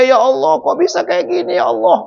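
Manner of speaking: anguished lament. Retold or imitated. Husband lamenting the oversleep.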